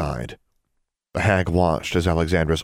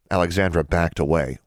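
plain reading voice

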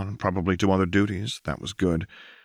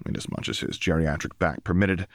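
ordinary delivery

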